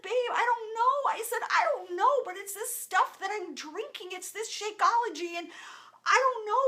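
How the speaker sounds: high, loud and thrilled, telling her husband; bursting with excitement as she explains; excited. Retold or imitated. Wife excitedly telling her husband what changed her.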